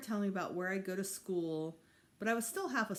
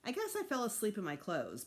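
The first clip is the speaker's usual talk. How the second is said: ordinary delivery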